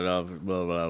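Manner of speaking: ordinary talk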